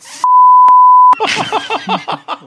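very high, loud shrieking laugh. Real, not acted. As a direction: burst into a shrill cackle. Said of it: "What is wrong with you?".

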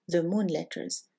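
usual speaking voice

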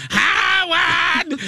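high screechy Brooklyn fan yelling Howard; screechy Marianne. Retold or imitated. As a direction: squeal the name like his biggest fan. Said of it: "a really screechy voice".

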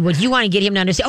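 ordinary delivery

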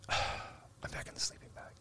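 soft, slow, unvoiced whisper; a whisper from deep hypnosis; hypnotic whisper. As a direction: barely breathe it out, half asleep. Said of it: "just a little whisper".

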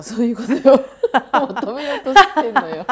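loud laughing insistence. Real, not acted. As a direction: laugh through the words. Teasing friend, laughing loudly.

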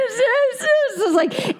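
high-pitched hammed-up sobbing; fake comedy crying. Shown as a demonstration. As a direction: burst into fake, laughable tears. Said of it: "bursting into fake comedy tears".

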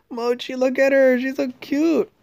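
high-pitched adoring coo; sudden high, doting squeal of affection. Real, not acted. Cooing over Mochi mid-conversation.

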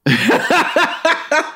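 high burst of laughter. Real, not acted. Co-host asked to do Groundskeeper Willie, high laughter.

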